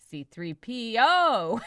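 slow "C three P O" with a drawn-out O; stress on syllable. Shown as a demonstration. Asking listeners which syllable they emphasize, drawn-out stress on "O". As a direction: lean long on the final O of "C three P O". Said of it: "the syllable that you emphasize".

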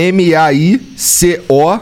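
slow, letter by letter; spelling it out. Shown as a demonstration. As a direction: spell each letter slowly. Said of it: "vou soletrar de novo".

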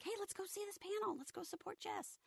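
bright high excited invite. Retold or imitated. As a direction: pitch way up, eager to go. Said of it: "I told my friends, like".

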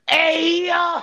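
high loud shocked yell; shocked shout. Real, not acted. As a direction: yell out in disbelief at the burn. Jersey jab at the fan.

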